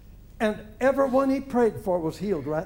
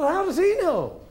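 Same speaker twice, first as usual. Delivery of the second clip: high, loud, incredulous question; retold shock, pitched way up; astonished thought; 9 semitones higher, 5 dB louder than usual. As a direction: blurt it in comic disbelief. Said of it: "I thought".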